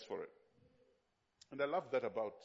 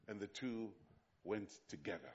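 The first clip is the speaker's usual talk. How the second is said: softer, lower, let the words settle; slow reverent reading; 6 semitones lower, 6 dB quieter than usual. Shown as a demonstration. Preacher savoring the Bible line for the congregation, soft and low. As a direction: read it with quiet reverence. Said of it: "I love the way the Bible is written".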